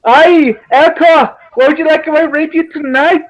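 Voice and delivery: badly faked Geordie lilt; bad Geordie accent. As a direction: attempt Geordie and land near Welsh. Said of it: "really bad Welsh accent".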